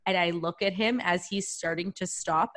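ordinary talk

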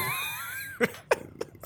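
high squealing laugh; a real high shriek of laughter. Real, not acted. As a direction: let out a piercing cackle. Bursting laugh.

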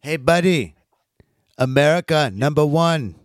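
hammed-up Turkish accent greeting a buddy; terrible Turkish accent. Cohost mocking a Turkish player, in a bad Turkish accent. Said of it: "terrible Turkish accent".

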